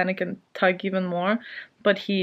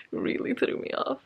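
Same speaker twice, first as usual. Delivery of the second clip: whispered repeat; 4 semitones higher, 6 dB quieter than usual. Real, not acted.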